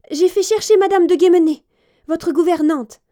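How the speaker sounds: high, loud, panicked chambermaid; a servant trying to hide her alarm; breathless lady's maid reporting to her mistress; flustered maid. A narrator's reading. Maid voiced in story.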